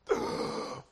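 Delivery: sudden breathless gasp of waking; sharp gasp. Pretend. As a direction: gasp back to life. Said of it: "a guy go".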